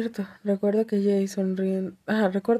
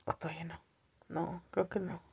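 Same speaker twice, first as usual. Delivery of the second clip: whispered question to herself; 2 semitones lower, 12 dB quieter than usual. Real, not acted. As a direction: breathe the question, unvoiced. Unsure aside to herself. (pregunta susurrada)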